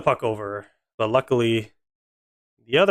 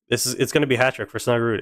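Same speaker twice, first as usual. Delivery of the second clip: ordinary talk, nothing marked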